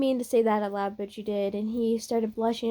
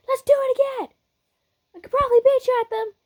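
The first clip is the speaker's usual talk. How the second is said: very high, loud, fast challenge; eager competitive fighter; 14 semitones higher, 5 dB louder, much faster than usual. Acted. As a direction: turn it into a contest, shrieking high.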